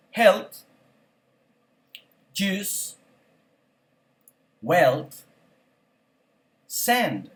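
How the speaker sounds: each word said alone, then a long pause to repeat; vocabulary drill. Shown as a demonstration. Teacher reads word list for class to repeat. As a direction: give the word and wait for the echo.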